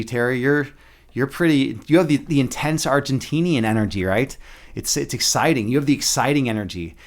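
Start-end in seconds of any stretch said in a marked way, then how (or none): none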